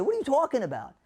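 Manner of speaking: high-pitched mock protest; acted-out indignant comeback; arguing. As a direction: argue back in disbelief, pitch jumping up. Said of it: "you're gonna argue".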